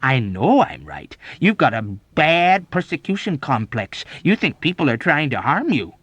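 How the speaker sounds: overbearing insistence; berating. A narrator's reading. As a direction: hammer the diagnosis home. Major berates patient in quarrel.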